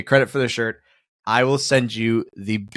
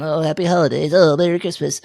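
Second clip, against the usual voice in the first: generic greeting; 5 semitones higher than usual. Retold or imitated. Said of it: "everyone's going".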